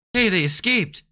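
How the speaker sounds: loud, high shout of alarm. Acted. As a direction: yell that the prisoners escaped. Guards raise alarm.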